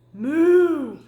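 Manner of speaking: loud, drawn-out moo; big cartoon cow moo. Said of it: "said Cow quickly".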